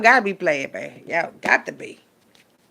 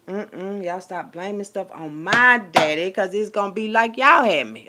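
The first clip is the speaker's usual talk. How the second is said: ordinary delivery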